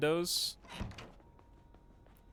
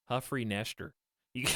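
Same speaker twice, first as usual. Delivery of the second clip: ordinary talk, nothing marked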